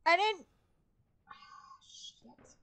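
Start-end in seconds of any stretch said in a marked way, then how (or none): 1.3-2.6 under the breath swear; whispered curse. Real, not acted. Gamer cursing her own slip in a whisper. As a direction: hiss it to yourself. Quiet curse after mistake.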